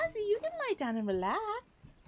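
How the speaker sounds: high loud coaxing voice. Real, not acted. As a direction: coax the dog to lie down. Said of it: "revealing my baby talk".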